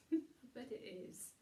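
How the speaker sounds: usual speaking voice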